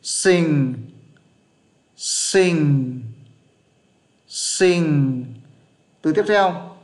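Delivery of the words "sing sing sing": "Sinh" stretched long with a falling tone; slow English "sing", one word at a time; repeat-after-me model. Shown as a demonstration. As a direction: hold the ng of "Sinh" for learners to copy. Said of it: "Các bạn phát âm theo nhé".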